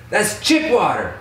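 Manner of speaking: high, mock-exasperated retort; imitated scolding. Max explaining the water to him, mock scolding.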